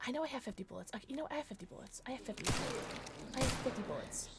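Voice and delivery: muttering to self. Real, not acted.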